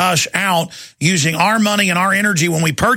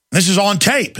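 normal talking voice

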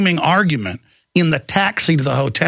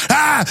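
frustrated yell; 9 semitones higher than usual. Retold or imitated. Taxi argument, shout.

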